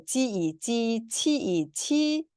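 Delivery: slow, drawn-out zhi chi syllables; 지, 치 drawn out as Chinese retroflex syllables; Chinese syllable drill. Shown as a demonstration. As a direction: sound out 지이 and 치이 slowly. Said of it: "저, 에".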